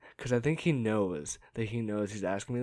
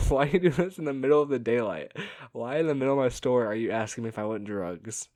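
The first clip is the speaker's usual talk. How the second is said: exasperated; 2 semitones higher, 6 dB louder than usual. Retold or imitated. Exasperated reply to drug offer.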